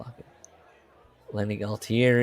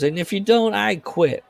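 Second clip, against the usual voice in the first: higher, a touch of a threat; mildly voiced employee quitting; mock ultimatum; 10 semitones higher than usual. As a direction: put your foot down, lightly acted. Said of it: "he goes".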